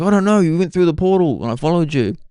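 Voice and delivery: high, excited explaining; a retold, half-hammed flustered answer; flustered reply.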